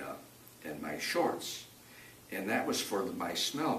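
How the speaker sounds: normal talking voice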